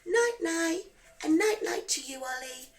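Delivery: plain reading voice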